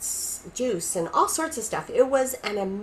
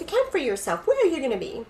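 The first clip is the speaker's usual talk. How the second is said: higher-pitched mock interrogation; cheeky, raised-pitch retold demand; smart-aleck teasing; 6 semitones higher, faster than usual. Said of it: "I was being a smart aleck".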